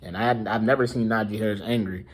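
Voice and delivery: normal talking voice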